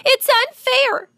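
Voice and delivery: high, drawn-out tearful wail; sobbed protest. A narrator's reading. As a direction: wail it slowly like a distraught child. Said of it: "she sobbed".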